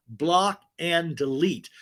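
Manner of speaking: BLOCK AND DELETE at half speed; emphatic slow command. Real, not acted. Host warning women out there, slow emphatic command. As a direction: spell out BLOCK, AND, DELETE as separate weighty words.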